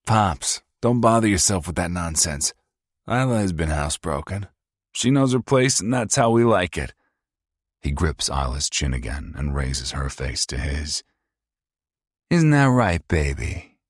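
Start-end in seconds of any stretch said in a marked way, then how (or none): 0.1-6.9 high, loud, fast mocking sneer; sneering abusive son. Paul voiced again. 12.3-13.8 high, loud, fast mocking sneer; sneering abusive son. Paul voiced again.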